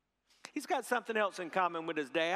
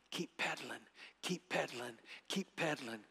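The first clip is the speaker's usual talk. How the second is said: soft, low-pitched steady coaching; low in the ear; 5 semitones lower, 7 dB quieter than usual. Retold or imitated. Father beside his son's bike, saying it softly in his ear. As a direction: repeat it quietly, like running alongside. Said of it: "saying in your ear".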